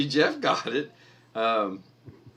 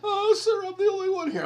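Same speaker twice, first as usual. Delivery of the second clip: very high, whiny, teary mimic; mock tearful cashier; 7 dB louder than usual. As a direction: squeak it out like a clerk about to cry. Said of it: "broke out in tears and goes".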